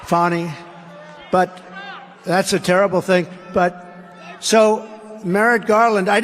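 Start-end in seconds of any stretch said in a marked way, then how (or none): 0.0-0.5 "Fani" said as FAH-nee, with a broad first A; corrected pronunciation. Shown as a demonstration. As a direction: pronounce "Fani" to rhyme with Connie. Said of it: "a little French accent there".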